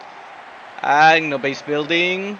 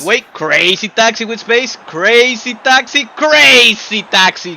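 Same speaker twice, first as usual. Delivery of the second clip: excited call; 6 semitones higher, 5 dB louder than usual. Real, not acted. Commentator calls a goal chance.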